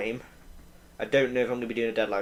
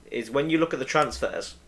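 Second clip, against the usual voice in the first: normal talking voice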